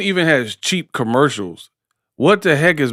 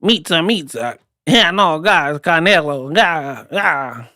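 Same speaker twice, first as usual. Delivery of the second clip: mock foreign accent, stretched words; hammed-up accented English; Triple G; 3 semitones higher, slower than usual. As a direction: imitate a Kazakh boxer, accent bouncing like a jingle. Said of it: "That's how Triple G did it".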